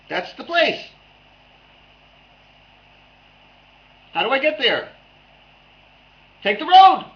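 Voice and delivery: high, loud, playful dialogue between two voices; rapid high back-and-forth. Acted. Acted Alice and Cat dialogue.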